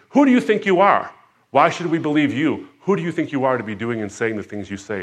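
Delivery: loud, high, scornful demand; outraged officials challenge Jesus; indignant challenge. Retold or imitated. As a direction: confront him as the affronted authorities. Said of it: "authorities look at him and say".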